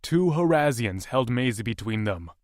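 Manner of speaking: usual speaking voice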